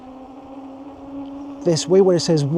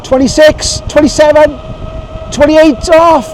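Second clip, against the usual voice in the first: excited calling out; 9 semitones higher, 10 dB louder than usual. Real, not acted. Calling out speed downhill.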